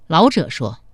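ordinary delivery

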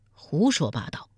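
ordinary delivery

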